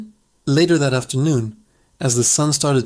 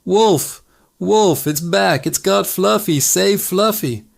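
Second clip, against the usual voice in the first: higher, breathless fake cry for help; hammed-up scream; 5 semitones higher, slower than usual. Boy faking a wolf attack to the villagers, hammed-up screaming. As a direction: overact the alarm, drag out the last plea. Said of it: "a truly Oscar-worthy scream".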